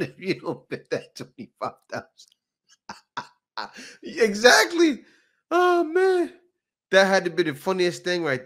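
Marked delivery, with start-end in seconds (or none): 0.0-6.3 breaks into high laughter on "Exactly"; real laughter. Real, not acted. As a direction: laugh helplessly at the joke. Cracking up at clip.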